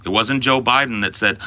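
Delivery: ordinary delivery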